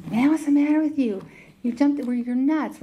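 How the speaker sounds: can't believe he jumped in, shout it; incredulous outburst. Retold or imitated. Trapped man scolds the rescuer.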